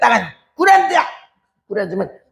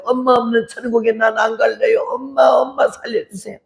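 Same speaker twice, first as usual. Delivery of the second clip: high, sobbing wail to God; crying prayer; 6 semitones higher than usual. Retold or imitated. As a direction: cry out begging God to save mother. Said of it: "얼마나 기도를 했는지 울면서".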